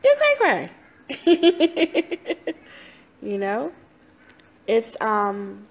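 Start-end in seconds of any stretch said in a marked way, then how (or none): 0.0-2.5 squealed very high and loud, breaking into a laugh; giggly teasing self-talk. Retold or imitated. Speaker mocking herself in self-talk, squealing high with laughter. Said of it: "I'm like".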